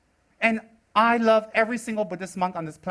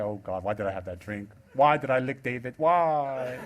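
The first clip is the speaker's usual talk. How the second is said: regretful J P voice; 8 semitones lower, 3 dB quieter than usual. Retold or imitated. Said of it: "J P's like".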